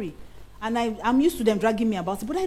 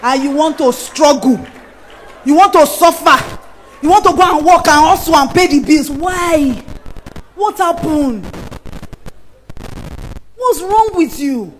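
louder, higher rebuke; loud scolding; 4 semitones higher, 8 dB louder than usual. Acted. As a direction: scold the hustlers at full volume. Preacher scolding women hustling.

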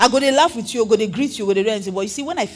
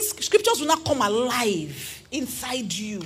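ordinary talk, nothing marked

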